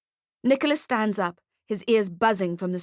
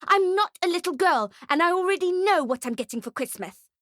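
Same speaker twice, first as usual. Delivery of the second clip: put-on high, indignant child outburst; bratty yell; 9 semitones higher than usual. A narrator's reading. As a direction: yell it like an offended little girl, pitch up. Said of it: "yells at him".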